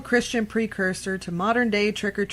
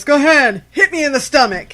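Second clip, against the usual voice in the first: Houdini's boast; 7 semitones higher, 12 dB louder than usual. Retold or imitated. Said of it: "that was his famous".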